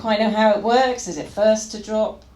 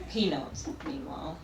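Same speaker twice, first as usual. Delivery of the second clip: usual speaking voice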